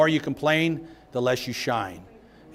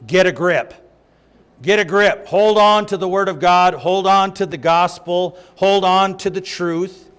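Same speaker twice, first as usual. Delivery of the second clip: preaching exhortation; 3 semitones higher, 4 dB louder than usual. Acted.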